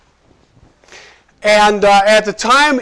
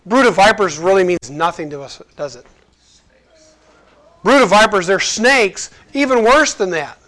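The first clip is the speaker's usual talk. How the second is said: ordinary delivery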